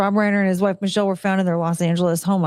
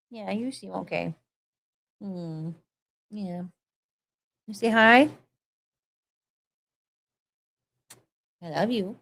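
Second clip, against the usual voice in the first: much softer, murmured tenderness; hushed pet talk; 6 dB quieter than usual. Real, not acted. Soothing pet after fall.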